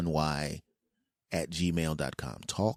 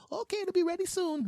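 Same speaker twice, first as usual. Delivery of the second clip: cheerful restaurant worker. Retold or imitated. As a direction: chirp the order back in falsetto.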